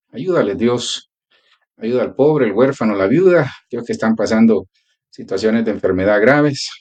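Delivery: earnest pleading prayer voice. Acted. Pastor praying for the sick and the poor, earnest prayer. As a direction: pray it out to God, steady and fervent.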